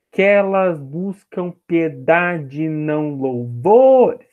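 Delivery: slow, measured syllables, last stress drawn out; scanning the verse. Shown as a demonstration. Teacher demonstrating joined sounds, slow syllable-by-syllable scansion. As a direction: pace the verse out in poetic syllables. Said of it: "piedade que junta com o ezinho".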